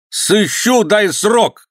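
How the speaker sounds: high-pitched menacing cry; angry threat. A narrator's reading. Governor keeps threatening Ivan shrilly. (угроза высоким сердитым голосом)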